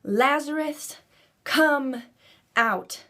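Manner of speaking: high, loud call to the tomb; Jesus shouting at the tomb. Acted. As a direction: call him out of the grave with full force. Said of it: "he shouted with a loud voice".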